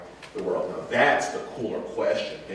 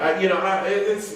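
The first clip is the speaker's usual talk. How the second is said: usual speaking voice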